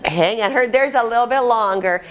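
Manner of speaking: usual speaking voice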